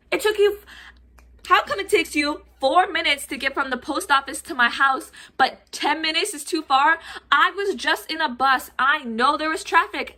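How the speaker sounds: high, exasperated mock rant; hammed-up complaint to a delivery driver; indignant protest. Acted indignant argument with delivery driver.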